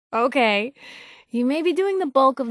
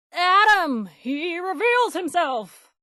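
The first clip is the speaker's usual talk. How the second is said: high, sudden proclamation, slowing down; blurted fake prophecy; 8 semitones higher, slower than usual. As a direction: cry out like a prophet to save your skin. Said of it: "suddenly I blurt out".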